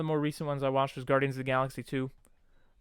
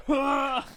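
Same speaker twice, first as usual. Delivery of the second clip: high, exclaimed "ha"; mock outburst, pitched way up; Drax's noise; 10 semitones higher, 3 dB louder than usual. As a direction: imitate Drax with a sudden high burst. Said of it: "That is the noise I make".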